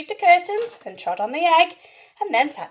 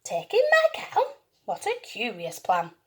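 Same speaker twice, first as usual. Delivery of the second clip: surprised; 3 semitones lower, 6 dB quieter than usual. Acted. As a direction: squeal it in disbelief. Lady baffled by odd advice.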